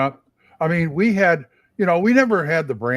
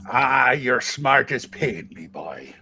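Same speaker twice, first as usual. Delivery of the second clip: put-on old pirate voice; 3 semitones lower, slower than usual. Pirate character speaks.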